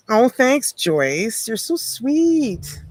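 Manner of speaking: high, drawn-out sweet thanks; gushing thanks. Real, not acted. Host thanking a kind viewer, gushing. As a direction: coo it high, stretching the end.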